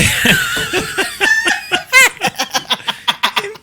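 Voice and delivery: long, high-pitched laughing fit. Real, not acted. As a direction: crack up in high, drawn-out laughter. Laughing at accent impression.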